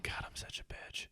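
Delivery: whispered self-reproach. Retold or imitated. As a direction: breathe it out under your breath. Self-mocking aside to girlfriend.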